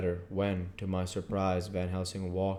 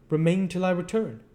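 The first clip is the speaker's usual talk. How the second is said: higher voiced, earnest foreign professor; foreign old professor; 11 semitones higher, 6 dB louder than usual. Professor explains to his colleague why he checks papers, higher and earnest. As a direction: speak as the learned foreigner, insistent. Professor gives short order.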